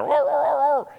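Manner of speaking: high mock dog barking noises; dog talk. Preacher answering as the dog in dogese, mock barking. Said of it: "I guess in dogese there".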